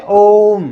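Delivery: ओम held long like a mantra, rising into the hum; drawn-out Om. Shown as a demonstration. Teacher demonstrating the mantra Om, long and chanted. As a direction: chant ओम slowly, stretching the vowel. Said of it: "कैसा बोलते हैं?".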